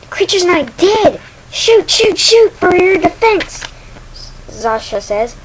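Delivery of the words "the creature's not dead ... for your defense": high, loud cries of alarm; panicked shouting. Acted. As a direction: shout an urgent warning. Zasha panics at living creature.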